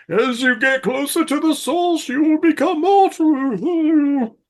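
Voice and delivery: high, slow, otherworldly sage; mystic guru voice. Retold or imitated. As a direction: intone it like a spooky oracle, drawn out. Quoting the Blob guy mystically.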